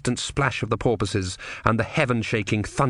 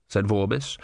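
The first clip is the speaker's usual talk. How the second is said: ordinary delivery, nothing marked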